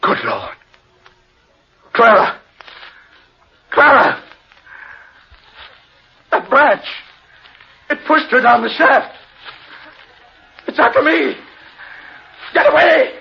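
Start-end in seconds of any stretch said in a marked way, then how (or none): 1.9-13.1 high, loud cries for help; panicked shouting. Acted. Humphreys shouts for help as the tree attacks. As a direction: terror building to being choked.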